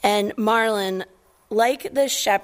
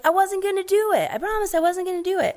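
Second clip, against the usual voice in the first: put-on high, fast whiny kid protest; 13 semitones higher, faster than usual. Pastor voicing Nemo protesting to his dad, high fast whine. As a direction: whine it quickly like a caught child. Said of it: "And Nemo's like".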